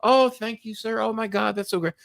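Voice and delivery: a young operator gushing to a caller, voiced high and sweet; imitating sweet Filipino operator. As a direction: play the chirpy call-center girl. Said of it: "[name] answers the phone".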